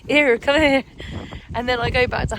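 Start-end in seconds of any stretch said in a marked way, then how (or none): 0.1-0.8 a louder broad Somerset "Here, come here."; playful Somerset shout; broad Somerset call. Shown as a demonstration. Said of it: "it gets broader again".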